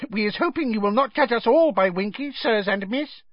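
very high, apologetic squeak; high-pitched servile little voice; squeaking elf. A narrator's reading. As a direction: apologise as a small, ashamed house elf. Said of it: "squeaked a nearby elf".

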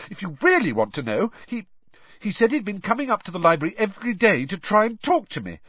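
fast, rushed, embarrassed gabble; very quickly. A narrator's reading. Hermione explaining Krum's shy interest, very quickly. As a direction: rush through it before you blush. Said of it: "Hermione said this very quickly".

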